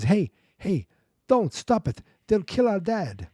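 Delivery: high-pitched imitation of frantic kids; urgent child's plea. Children beg to save their dad.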